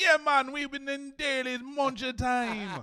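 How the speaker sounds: mock Jamaican patois.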